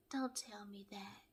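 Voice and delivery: very soft whispered reply; imitated whisper. Paige leaning back to answer Chris, imitated whisper.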